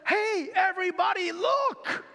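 high, loud shout to the crowd; excited call-out. Retold or imitated. Stephen calling the angry crowd, high loud shout. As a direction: call everyone over to see. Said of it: "The first thing he says".

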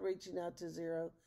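usual speaking voice

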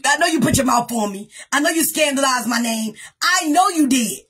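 ordinary delivery

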